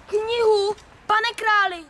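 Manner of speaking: gasp, then a loud shocked question; outraged gasp to the king. Acted. Šokovaný dvořan.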